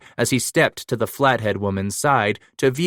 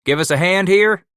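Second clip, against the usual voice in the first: higher and louder call; 10 semitones higher, 6 dB louder than usual. A narrator's reading. Titus calling across the corral to young Paddock. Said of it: "Titus called out".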